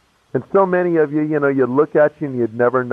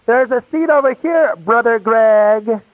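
much higher, slow, sing-song call across the room; friendly usher calling out; 9 semitones higher, slower than usual. Retold or imitated. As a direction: call out sweetly to a newcomer, stretching the name. Someone calls him to a seat.

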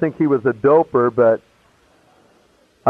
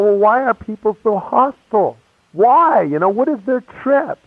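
higher, puzzled questioning; baffled complaint; 8 semitones higher than usual. Retold or imitated. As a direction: ask it like you truly can't understand people. Retelling his own upset question.

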